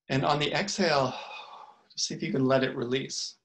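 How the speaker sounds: unhurried meditation guide with long pauses; calm, spacious guidance, letting each phrase settle; guided meditation. Real, not acted. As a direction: lead them gently into the breath, phrase by phrase.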